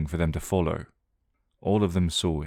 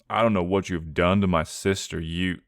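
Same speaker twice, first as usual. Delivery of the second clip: usual speaking voice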